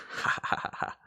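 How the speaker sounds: soft, voiceless mock laugh; hushed pretend "ha ha ha"; secret gleeful laugh. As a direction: imitate a sneaky private chuckle. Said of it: "Secretly, she's just like".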